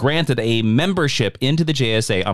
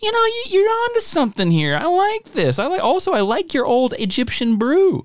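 much higher, simple dopey voice; Atom Smasher; 12 semitones higher than usual. Retold or imitated. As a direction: sound like a happy, simple big guy. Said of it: "And Atom Smasher goes".

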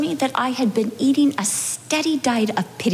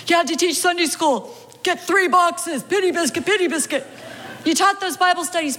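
higher, sing-song coddling voice; mock pity voice; 4 semitones higher than usual. Inner voice soothing the overworked wife, sing-song pity. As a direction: coo "pity biscuit" like feeding a pet.

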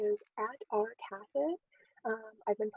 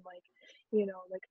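ordinary talk, nothing marked

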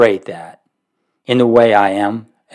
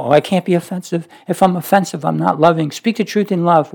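mocking timid men; 6 semitones higher than usual. Mocking soft-spoken church men.

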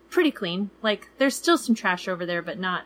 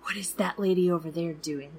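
hushed, suspicious whisper; 3 semitones lower, 5 dB quieter than usual. Retold or imitated. Wary traveler eyeing a lady nearby, whispered. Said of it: "you start getting suspicious".

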